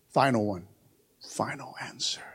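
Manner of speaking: hushed whispered punchline; breathy stage whisper for effect. Acted. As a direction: drop it to a whisper.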